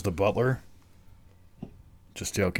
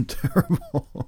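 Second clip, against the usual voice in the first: laughing tease; 8 semitones higher, much slower than usual. Real, not acted. Teasing repetition while laughing.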